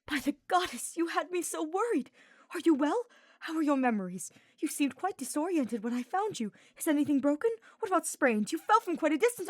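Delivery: high, loud, anxious rush of questions; worried fussing. A narrator's reading. Ferdinand fussing over injured Hubert, high anxious questions. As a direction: pour out your worry over him. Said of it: "with a stricken expression".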